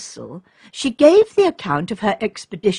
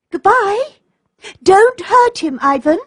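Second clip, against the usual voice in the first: high, louder, pleading "Goodbye? Don't hurt him"; a girl begging for the boy; crying out; 11 semitones higher, 4 dB louder than usual. A narrator's reading. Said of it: "cried Olga".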